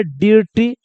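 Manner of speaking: "डीरटी" with a long ee before r; mistaken reading. Shown as a demonstration. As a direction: demonstrate the misreading DEER-tee, "डीरटी". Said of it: "ऐसे पढ़ सकता है".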